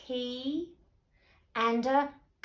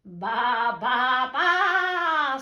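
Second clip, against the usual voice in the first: sung bleating baa; sing-song baa baa baa; hen baaing; 9 dB louder, much slower than usual. A narrator's reading. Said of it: "said the fat red hen".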